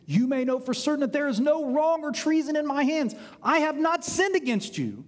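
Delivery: high, insistent protest of innocence; emphatic declaration. Acted. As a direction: declare your innocence with rising fervour. David protests innocence to Saul.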